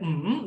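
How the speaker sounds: hummed MM dipping, then rising; third tone MM. Shown as a demonstration. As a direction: hum MM down and back up. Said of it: "Nada tiga itu".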